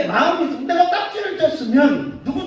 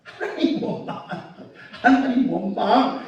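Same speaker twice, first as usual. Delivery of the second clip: usual speaking voice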